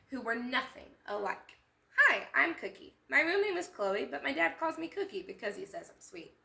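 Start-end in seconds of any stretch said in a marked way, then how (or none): 2.0-6.4 put-on bright, higher girl voice, eager to introduce herself; Cookie's voice. Cookie greeting the listening children, upbeat. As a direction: play a cheerful little girl.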